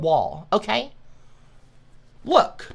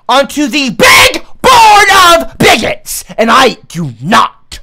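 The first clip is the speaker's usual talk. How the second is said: slow, loud, high yelled decree; announcing the bigot board to chat, loud and slow; loud proclamation; 7 semitones higher, 13 dB louder, slower than usual. Real, not acted. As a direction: bellow the sentence out slowly.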